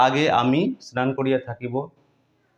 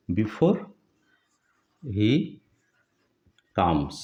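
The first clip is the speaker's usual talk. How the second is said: one word at a time, long pauses; slow dictation; 4 semitones lower, much slower than usual. Shown as a demonstration. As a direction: dictate slowly for someone writing it down. Teacher dictates while writing it out.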